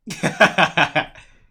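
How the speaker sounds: loud, high cackle of laughter; full laughing fit; loud laugh burst. Real, not acted. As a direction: let out a big loud laugh.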